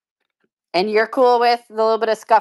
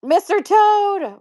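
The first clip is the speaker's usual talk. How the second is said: excited reveal; 12 semitones higher, 6 dB louder, much slower than usual. Real, not acted. Delighted at a rare pin.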